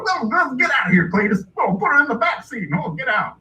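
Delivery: high, swooping orders to the son; exasperated hillbilly father. Retold or imitated. Pa orders Cletus out, exasperated hillbilly. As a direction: shoo the boy out like a rube dad. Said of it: "He's like".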